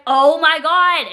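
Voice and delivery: loud, drawn-out exasperated Oh my God. Retold or imitated. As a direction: let it out slowly at your partner, fed up. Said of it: "now mad at Austin".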